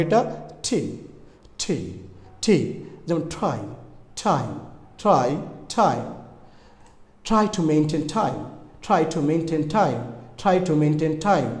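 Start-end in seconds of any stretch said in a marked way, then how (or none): 0.6-2.8 ঠি said with tongue touching the upper gum; pronunciation demo. Shown as a demonstration. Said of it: "উপরের দাঁতের মাড়িতে স্পর্শ করতে হবে". 3.2-6.0 try, time as isolated falling words, tongue to upper gum on the t; pronunciation drill. Shown as a demonstration. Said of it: "উপরের দাঁতের মাড়িতে স্পর্শ করতে হবে". 7.3-11.5 slow, measured "try to maintain time", each t made on the upper gum; repetition drill. Shown as a demonstration. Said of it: "এভাবে অনেকবার বলুন".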